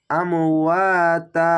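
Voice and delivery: আমওয়াতা chanted slowly, the long a held; Quranic recitation. Shown as a demonstration. Teacher models the pause word for students, slow. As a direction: hold the vowel of আমওয়াতা one alif. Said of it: "এক আলিফ টেনে থেমে যাবেন".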